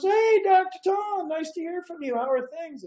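put-on elderly Betty voice. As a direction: play the kindly elderly patient on the phone. Betty voiced in retold call.

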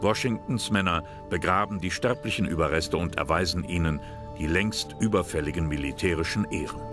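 ordinary delivery

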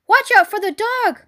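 high-pitched warning cry; cried warning. A narrator's reading. Sheep warning Wilbur of the spaniel, cried warning. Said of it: "cried the sheep".

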